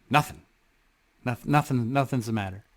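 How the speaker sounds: soft, withdrawn mumble of nothing; quiet denial. Retold or imitated. To friends asking what's wrong, a quiet withdrawn denial. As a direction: play down the question, voice dropping away.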